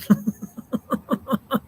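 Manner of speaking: high, long giggling laugh; laughter. Real, not acted. Streamer laughing at his own dumb American joke, giggling. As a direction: laugh helplessly in a long run of chuckles.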